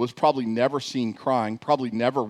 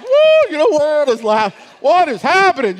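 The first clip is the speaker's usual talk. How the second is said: flustered exclamation; 13 dB louder than usual. Retold or imitated. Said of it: "I'm like".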